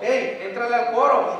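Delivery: calling out loudly to join the choir; a loud, urging invitation. Acted. Preacher voicing an invitation to the congregation, a loud call.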